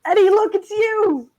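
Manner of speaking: put-on high, loud, amazed child; excited kid. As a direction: gush like a thrilled kid.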